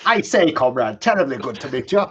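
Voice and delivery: a clipped upper class English officer forced to be polite; upper class English accent. Acted. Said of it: "upper class accent will indeed".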